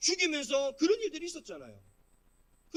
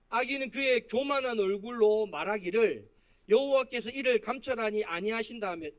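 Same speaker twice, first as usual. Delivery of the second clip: scripture reading; 5 dB louder than usual. Acted. Pastor leading the congregation in reading the psalm, scripture reading. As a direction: read the verse out firmly for all to follow.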